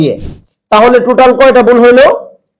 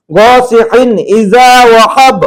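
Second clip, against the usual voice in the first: slow, drawn-out tajweed chant; Quran recitation; slower than usual. Shown as a demonstration. Teacher reciting the correct verse slowly, like a Quran reciter. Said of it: "দেখেন এই যে অনেস্করের মতো দেওয়া".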